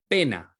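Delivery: "pena" anunciada, un poco más fuerte; new word revealed. Shown as a demonstration. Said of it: "y pongo p".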